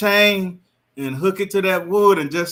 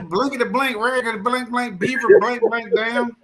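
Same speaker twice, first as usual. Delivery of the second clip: mock cussing; 7 semitones higher, 3 dB louder than usual. Bleeped-style mock cussing.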